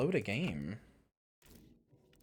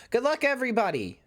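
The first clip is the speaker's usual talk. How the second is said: bright sign-off; 10 semitones higher, 3 dB louder than usual. Shown as a demonstration. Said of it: "I'm gonna end my streams by saying that".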